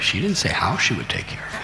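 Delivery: ordinary delivery, nothing marked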